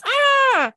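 sudden loud high yelp; startled yell. Acted. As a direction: jump and shout ah. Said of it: "he yells".

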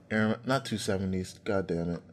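ordinary delivery

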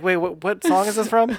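surprised. Retold or imitated. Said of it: "I was like".

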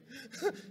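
short laugh. Real, not acted. Preacher laughs at vision.

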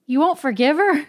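loud, high cry of disbelief; frantic reproach. Acted. Mitya reproaching Grushenka for not forgiving, frantic cry. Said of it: "cried Mitya with frantic repro-, reproach".

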